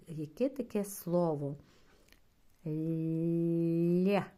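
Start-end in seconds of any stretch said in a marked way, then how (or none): 2.7-4.2 Лє with a long soft л; long palatal л before є; drawn-out soft Лє. Shown as a demonstration. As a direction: stretch the soft л in Лє. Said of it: "Що ми чуємо".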